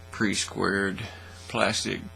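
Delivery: ordinary delivery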